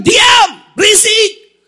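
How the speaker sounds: high, loud, slow shout to silence him; harsh hush. Acted. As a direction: bark at the blind beggar to shut up. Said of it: "Orang bilang". (bentakan keras dan pelan)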